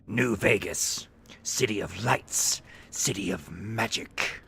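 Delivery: slow, low Morrowind elf voice; slow breathy hiss through teeth; Dunmer voice. Retold or imitated. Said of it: "[name] is trying to talk like a ghoul ... Dunmer voice".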